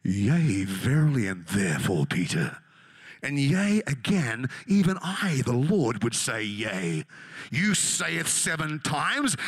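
booming, very deep mock-divine voice; hammed-up voice of the Lord, loud and low; Jesus. Imagined Lord answering Peter's question, mock-solemn and booming. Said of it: "if Jesus actually speaks like that".

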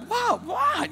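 high squeaky double what; imitating baffled confusion. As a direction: act bewildered and innocent. Confused at lady's gesture.

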